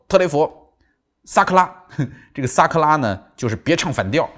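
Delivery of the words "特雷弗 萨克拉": mother's firm, louder warning to her son; stern warning. Retold or imitated. Mother silences her arguing son, a firm loud warning. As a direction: lay down the law, mock stern. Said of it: "他妈妈说".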